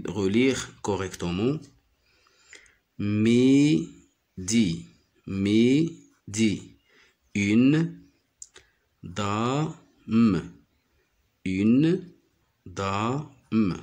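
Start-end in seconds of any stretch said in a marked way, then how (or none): 3.0-6.7 midi split into "mi - di", each held long; teacher's drawn-out mi... di; syllable by syllable. Shown as a demonstration. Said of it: "J'y découpe les mots comme ça". 7.3-13.7 "une" held long, then "da - me"; slow, separated beats for une dame; syllable by syllable. Shown as a demonstration. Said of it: "J'y découpe les mots comme ça".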